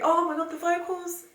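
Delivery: high, loud gush of delight; overwhelmed fan squeal; excited gasp. Real, not acted. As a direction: burst out over the vocals. Gushing over song vocals.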